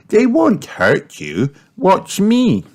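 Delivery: put-on high, slow child voice; encouraging piglet. A narrator's reading. Peppa reassuring scared Richard, encouraging. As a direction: coax in a high little-girl voice.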